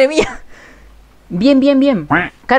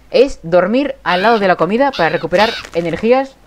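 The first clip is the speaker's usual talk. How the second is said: ordinary talk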